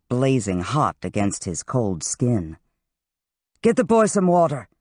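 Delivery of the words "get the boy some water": quick, higher-pitched order; commanding. A narrator's reading. Dean ordering water for the boy, commanding. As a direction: give a brisk command. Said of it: "Abelard commanded".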